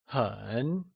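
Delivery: soft 很 with a dip and lift; falling-rising tone. Shown as a demonstration. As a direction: let 很 fall low, then rise. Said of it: "is said with a falling".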